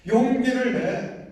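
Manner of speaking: high, loud, slow rallying cry; self-encouragement. Shown as a demonstration. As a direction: rouse your own courage. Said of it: "얘기해 주세요". (높고 크고 느리게 스스로 격려하듯)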